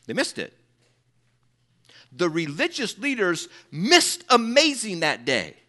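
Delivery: ordinary delivery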